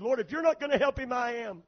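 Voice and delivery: frustrated ultimatum in prayer; at wit's end. Retold or imitated. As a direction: throw the challenge up to God, quick and high. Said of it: "I was at my wit's end".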